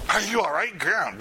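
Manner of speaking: high, brisk check-in; wrong way, high and brisk. Shown as a demonstration. Showing the class the pushy way to check on someone. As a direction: show the wrong pushy approach. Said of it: "walking up to that person and go".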